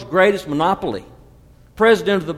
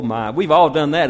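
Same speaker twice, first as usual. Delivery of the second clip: ordinary delivery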